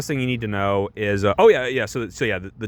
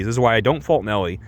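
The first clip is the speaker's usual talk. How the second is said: ordinary talk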